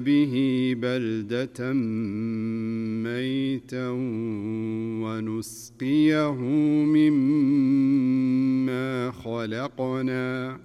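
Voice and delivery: slow, drawn-out chanted tilawa; Quran recitation. Acted. Sheikh recites the verses in slow chanted tilawa. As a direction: recite it with melodic tajweed.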